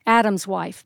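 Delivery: ordinary delivery, nothing marked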